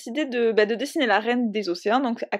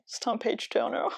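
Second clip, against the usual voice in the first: page-turner said the American way, softer; little American accent; 4 dB quieter than usual. Shown as a demonstration. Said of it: "Attention, petit accent américain". (petit accent américain sur page-turner)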